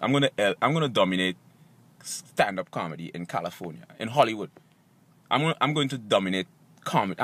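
very low, soft, deep voice; self-assured declaration. Acted. Comedian announcing he will dominate Hollywood, deep self-assured voice. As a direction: drop it very deep and keep it soft. Opens a staged confidence speech.

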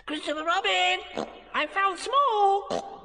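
very high, drawn-out squeal; slow, squeaky holler of discovery; excited cry. Acted. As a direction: announce a triumphant find. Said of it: "cried Piglet".

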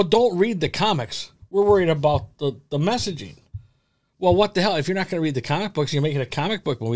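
ordinary talk

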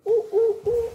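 chimpanzee call. Acted. Child reads the chimpanzee's line, loud monkey hoots. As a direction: hoot like a playful monkey. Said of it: "says the chimpanzee".

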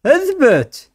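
sharp high-pitched order; high command. Real, not acted. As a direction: snap a high command at the pet. Commanding pet in game.

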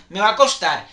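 usual speaking voice

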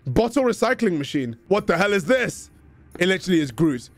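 ordinary delivery

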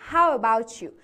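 "How about you" with "about" and "you" joined as "abou-chu"; linked pronunciation. Shown as a demonstration. Teacher showing students connected speech, "about you" glued into one word. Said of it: "about و you رو به هم چسبوندم".

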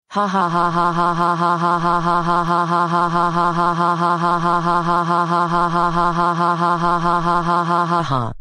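long gleeful villain cackle; evil cackle. Acted. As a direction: cackle on and on like a prankster. Prankster laughs after swapping food.

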